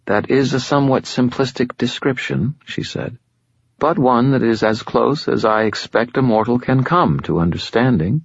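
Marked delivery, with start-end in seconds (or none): 0.1-2.6 higher, silky voice of an ancient fallen angel, calm and superior; fallen angel Lashiel. Acted. Lashiel speaks. 3.8-8.1 higher, silky voice of an ancient fallen angel, calm and superior; fallen angel Lashiel. Acted. Lashiel speaks.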